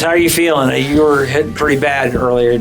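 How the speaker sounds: ordinary talk, nothing marked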